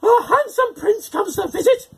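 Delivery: high, loud raging witch; shriek of rage. A narrator's reading. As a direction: shriek it furiously. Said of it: "the witch shrieked in rage".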